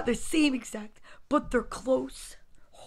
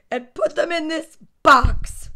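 slow, loud, raised command; ordering mom; 4 semitones higher, 8 dB louder than usual. A narrator's reading. Mom sending toys to the box, firm loud order. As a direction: finish the order firmly. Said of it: "she ordered".

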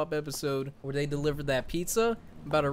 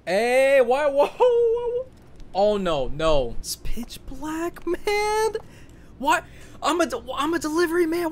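very high, loud panicked cries; real terror in the dark; panicked yelling; 12 semitones higher, 4 dB louder than usual. Real, not acted. Delivery man trapped in the dark, high panicked yelling.

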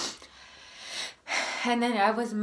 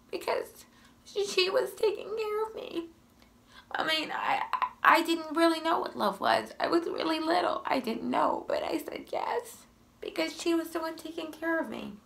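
tearful whisper; 8 semitones higher than usual. Real, not acted. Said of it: "it gets me emotional every time".

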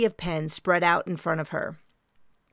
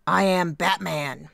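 Batman voice; slower than usual. Acted. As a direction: growl it like the movie Batman. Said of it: "Mary lowered her voice and said".

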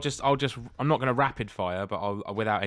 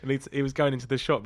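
ordinary talk, nothing marked

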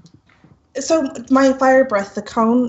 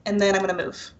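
usual speaking voice

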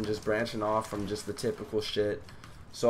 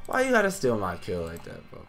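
subdued, wounded complaint; mock sad; 3 semitones lower than usual. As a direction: drop from outrage to playful hurt. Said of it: "I'm really sad right now".

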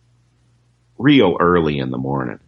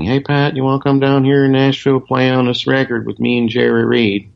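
high, fast mock phone message voice; imitating Chet's message; 7 semitones higher than usual. Guitarist recalls Chet's answering machine invite, high mock voice. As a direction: play back the invite in a higher voice. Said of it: "answering machine saying".

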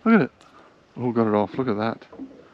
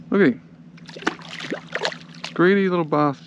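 higher, amused scolding of the fish; playful teasing; 5 semitones higher than usual. Real, not acted. Angler teases the greedy fish he caught, playful and higher.

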